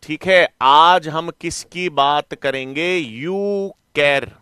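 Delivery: very drawn-out, syllable by syllable; slow teacherly repetition. Real, not acted. Teacher repeats the topic while writing it, slow repetition. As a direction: stretch each word as if writing it.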